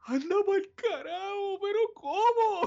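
high, slow, whiny fake sob; mock wailing. Retelling his reaction to Bambi's mother, mock wail. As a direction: ham up a childish cry of disbelief. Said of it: "Como que".

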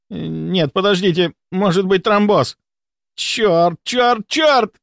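high, stammering panic with hesitations; frantic shouted commands. A narrator's reading. Volkonsky shouts contradictory commands to staff, frantic and high. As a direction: cry out orders, then reverse them in panic. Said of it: "выкрикивая противоречивые команды".

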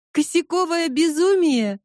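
high, amused outburst; excited exclaim. Acted. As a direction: blurt it out with glee. Laughing exclaim of film title. (радостный возглас)

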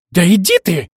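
loud, high indignant outburst; angry flare-up. A narrator's reading. Bride erupting at her rival's claim, angry loud outburst. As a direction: explode at the rival's boast. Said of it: "вспыхнула Злата".

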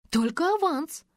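high, loud indignant protest; outraged at being shortchanged. A narrator's reading. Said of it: "возмутилась собеседница". (возмущённо)

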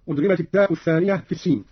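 usual speaking voice

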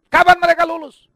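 loud, high frustrated cry; exasperated outcry. Acted. Father exasperated about his grown children, loud outcry. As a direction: throw up your hands, loud.

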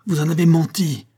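high, loud accusation; furious retort. A narrator's reading. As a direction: roar it back like a lion. Marin defends Monique to crowd. (Marin répond à la foule, furieux)

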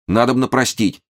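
high, earnest "простить" as a statement; agitated urging. A narrator's reading. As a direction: urge "простить" with a falling, pleading close. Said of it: "очень волнуясь". (взволнованно убеждает: «надобно простить»)

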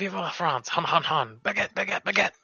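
hammed-up French accent, high; cartoon French cheer; mock French. Player mocking the French innkeeper with French accent.

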